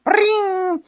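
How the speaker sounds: a high, sharp bell ring; imitated school bell. Mimicking the bell that ends detention, high ding. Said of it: "The bell rings".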